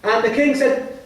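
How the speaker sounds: usual speaking voice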